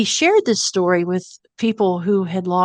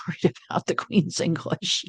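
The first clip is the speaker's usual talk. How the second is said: laughing; 6 dB quieter than usual. Real, not acted. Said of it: "And I laughed out loud".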